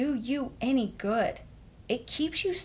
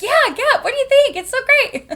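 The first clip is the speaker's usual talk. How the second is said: much higher, loud gushing squeal; squealing excitement; 11 dB louder than usual. Retold or imitated. Pitching the name Gap to Christina, squealing. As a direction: gush as if it's the best name ever. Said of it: "And I'm like".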